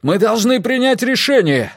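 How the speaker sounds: high, urgent yell. A narrator's reading. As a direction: shout to force a decision. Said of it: "заорал Миккель".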